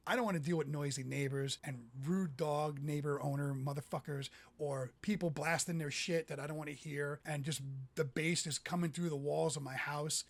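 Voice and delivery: normal talking voice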